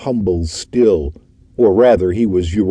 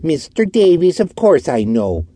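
higher, sneering reply; 10 semitones higher than usual. A narrator's reading. Orton sneering back at the detective. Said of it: "answered Orton with a sneer".

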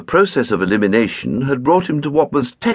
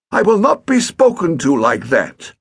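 higher, louder rebuke; indignant stepfather; 9 semitones higher, 4 dB louder than usual. A narrator's reading. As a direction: put the boy in his place.

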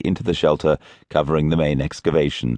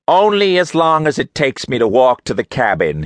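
curt, louder impatient snap; snapping; 8 semitones higher, 4 dB louder than usual. A narrator's reading. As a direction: bite the words off, dismissive. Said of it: "he snapped".

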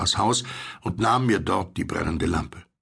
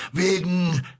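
high, drawn word left hanging; faltering, breaking off; 11 semitones higher than usual. A narrator's reading. Stranger starts to explain his fear, breaking off. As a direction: begin high, then stop short. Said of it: "setzte die Stimme an und hielt sogleich wieder inne".

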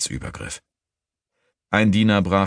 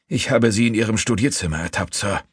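raised-pitch report; urgent accusation; 6 semitones higher than usual. A narrator's reading. Servant accusing thief before master.

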